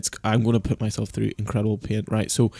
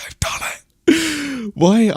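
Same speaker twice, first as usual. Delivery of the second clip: panicked cry; 14 semitones higher, 10 dB louder, much slower than usual. Real, not acted. Streamer panicking just after confirming his transfers. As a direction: whimper that breaks into a high loud gasping cry.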